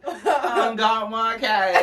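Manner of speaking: high, very slow mock Southern twang; Southern drawl. Showing how down south people talk, dragged Southern drawl. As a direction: stretch every word like a hammed-up Southerner. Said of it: "They really talk like that".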